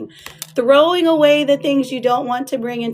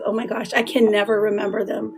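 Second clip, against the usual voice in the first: ordinary talk, nothing marked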